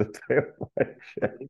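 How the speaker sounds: breaking into a laugh while talking. Real, not acted. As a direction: laugh your way through the words. Amused aside correcting hose pipe.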